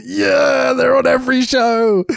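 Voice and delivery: high, drawn-out shouted aside; mock cheer. Host reading the match card, breaking into a mock cheer. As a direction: break into a mock crowd-pleasing yell.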